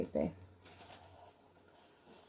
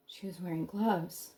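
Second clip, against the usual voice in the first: hushed; 8 dB quieter than usual. A narrator's reading. Hushed follow-up after the cry.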